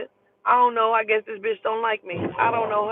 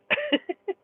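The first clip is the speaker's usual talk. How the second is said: high incredulous laugh. Real, not acted. Incredulous reaction.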